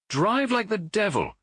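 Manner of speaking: high, slow shouted command to the cabman; urgent shout at the cabman; shouted order. A narrator's reading. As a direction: call out urgently to the driver. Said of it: "he shouted".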